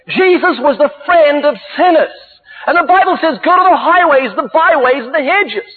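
high, forceful sermon delivery; impassioned preaching. Real, not acted. Preacher urges believers to go out among sinners, impassioned preaching.

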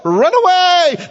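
high, slow comic wail; stretched mock panic yell; comic high cry. Disciples fleeing, comic.

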